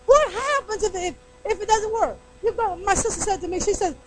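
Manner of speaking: normal talking voice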